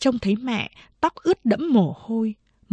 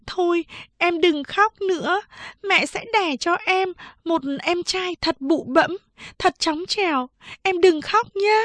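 put-on higher-pitched big sister comforting a crying child; soothing, coaxing; 9 semitones higher than usual. A narrator's reading. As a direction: coax a little sister out of tears. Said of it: "dỗ dành nó". (giọng chị cao, dỗ dành em)

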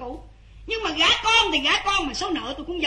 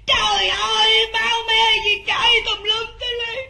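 wailing testimony; 3 semitones higher, 4 dB louder than usual. Acted. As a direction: cry out as if reliving the horror. Mother relives stabbing in court.

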